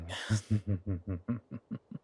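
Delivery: long staccato villain chuckle, fading out; extended predatory chuckle; dark, delighted chuckle. Acted. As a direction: laugh with relish at the thought of the hunt. Relishing the hunt.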